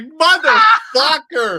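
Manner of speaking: slow drawn-out exasperated yell; loud, slow playful swear; mock shout. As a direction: stretch it out like a mock curse. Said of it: "And I was like".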